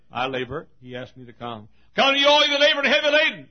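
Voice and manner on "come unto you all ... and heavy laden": high, loud, rapid pulpit cry; fervent preacher's shout; shouted proclamation. Acted. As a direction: belt out the invitation. Proclaims scripture to congregation.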